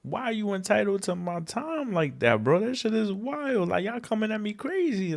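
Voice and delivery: exasperated disbelief. Retold or imitated. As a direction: act out the baffled outrage. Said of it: "you're like".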